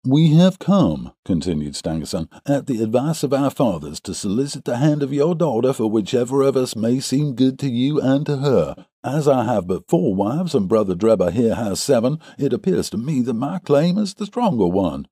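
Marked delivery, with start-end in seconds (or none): 0.1-1.1 high, loud, slow announcement; young visitor Stangerson. A narrator's reading. Suitor states his errand to the father, high-pitched. 2.5-15.1 higher-pitched, formal character voice; young suitor Stangerson. A narrator's reading. Suitor asks the father for his daughter, high-pitched.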